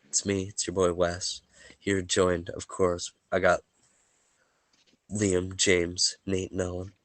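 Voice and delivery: ordinary delivery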